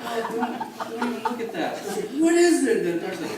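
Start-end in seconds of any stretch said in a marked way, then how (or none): 0.0-1.8 laughing warning. Retold or imitated. Dad replying to daughter, laughing. 2.2-2.8 put-on high, loud, laughing kid's voice; curious daughter. Dad voices his daughter asking the doctor, high and eager.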